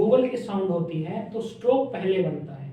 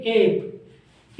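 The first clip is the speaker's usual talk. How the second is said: slow drawn-out ape. Shown as a demonstration. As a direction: say ape slowly. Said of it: "आप pronounce कीजिए मेरे साथ एप".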